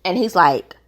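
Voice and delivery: usual speaking voice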